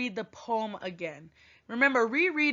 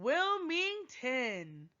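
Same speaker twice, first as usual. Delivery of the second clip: Wilmington stretched slowly and softly; drawn-out word; 3 semitones higher, 7 dB quieter, much slower than usual. Pretend. Teacher finishing the poem for her class, Wilmington drawn out slowly. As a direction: let Wilmington trail out slow and quiet. Reading the poem's last word.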